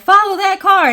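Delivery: loud, high movie-cop call; TV cop shout. Retold or imitated. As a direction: bark it like a TV detective. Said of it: "on T V when they be like".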